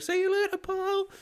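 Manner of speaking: very high, sing-song goodbye; mock cheery farewell. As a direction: chirp it as you stroll away. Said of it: "super soakers, like".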